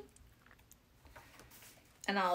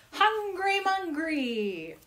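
slow, high, grand announcement; dramatic title; 9 semitones higher, much slower than usual. Acted. Presenting the poem title to viewers, grand. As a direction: announce it like a movie trailer.

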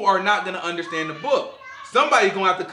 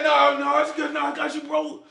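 fake brave denial; 9 semitones higher than usual. Acting someone holding back tears.

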